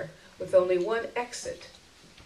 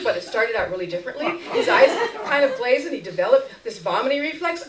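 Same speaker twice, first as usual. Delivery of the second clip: fast, higher, breathless worrying; anxious prattle; 6 semitones higher, 4 dB louder than usual. Acted. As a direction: chatter on nervously, barely stopping.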